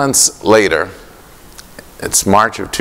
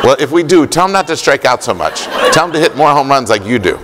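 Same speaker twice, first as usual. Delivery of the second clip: put-on higher pitch, quick, earnest kid; little boy's advice; 6 semitones higher, faster than usual. Boy on the phone telling Papi what to tell Millar, in a quick, high child's voice. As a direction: hand out advice like a small fan.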